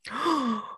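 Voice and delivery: sharp shocked intake of breath; dramatic gasp. Pretend. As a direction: gasp like you just saw a baby fall. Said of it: "The gasp in Espanol".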